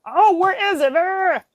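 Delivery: high, exasperated outburst; mock frustration. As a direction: act out losing patience, ending in a growled cry. Acting out frustration, then calming down.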